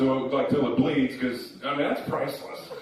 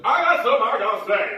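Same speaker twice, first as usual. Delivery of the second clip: put-on high-pitched drunken outburst; drunk interrupter; 8 semitones higher, 5 dB louder than usual. Drunk man interrupting the introductions, high and slurred. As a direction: butt in as a tipsy heckler. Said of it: "That's what he sounds like".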